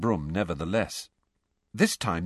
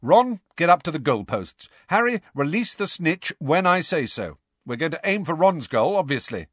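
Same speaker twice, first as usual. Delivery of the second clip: raised, higher captain's instructions; calling out orders; 7 semitones higher, 4 dB louder than usual. Acted. Angelina assigning positions to the team, calling out. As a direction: bark out the drill to each player. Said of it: "called Angelina".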